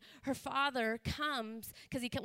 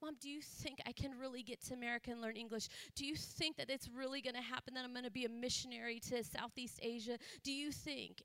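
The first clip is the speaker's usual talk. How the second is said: very soft, pleading questions; hushed, doubtful appeal to mom; retold tearful daughter; 8 dB quieter than usual. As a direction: imitate a crying daughter gently, without sobs. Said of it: "And she's crying. She's like".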